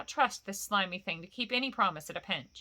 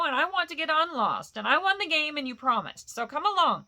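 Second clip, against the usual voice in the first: higher, louder insistent cry; raised, urgent appeal; 7 semitones higher, 6 dB louder than usual. A narrator's reading. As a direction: shout your demand across the dark. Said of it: "cried Bilbo".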